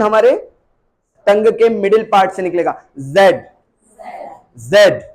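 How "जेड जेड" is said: जेड said hard from the middle of the tongue; a firm British जेड; hard British zed. Shown as a demonstration. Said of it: "British बोलते हैं इसको जेड, और ये hard sound होता है". (British सख्त जेड)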